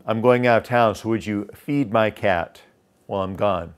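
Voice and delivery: casual request that descends like an instruction; embedded command. Shown as a demonstration. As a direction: question worded, falling through FEED MY CAT. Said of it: "I didn't ask you to feed my cat".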